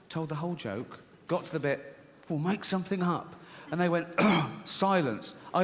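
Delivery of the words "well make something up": higher-pitched, mildly exasperated quote; retold exasperated punchline. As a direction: replay the fed-up father's line.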